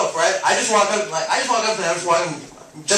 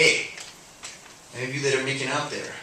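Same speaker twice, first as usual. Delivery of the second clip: creepy warning; 9 semitones lower, 8 dB quieter than usual. Pretend.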